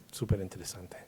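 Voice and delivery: soft unvoiced whisper, súper interesante; whispered aside. Real, not acted. Linguist whispering an aside to the audience about the verb. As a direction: breathe it out as a private aside.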